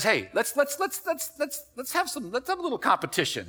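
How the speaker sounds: lightly voiced Samson proposing a game; playful Samson. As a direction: egg the men on like a sly strongman. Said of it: "and so Samson says".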